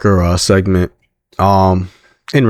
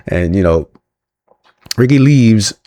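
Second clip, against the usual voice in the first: normal talking voice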